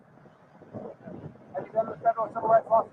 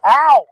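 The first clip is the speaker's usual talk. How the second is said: loud yelp of pain; 3 semitones higher, 12 dB louder than usual. Real, not acted. Cuffed man yelps.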